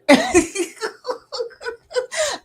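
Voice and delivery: a burst of high giggling; real amused giggle; high laugh. Real, not acted. Laughs at viewer's name.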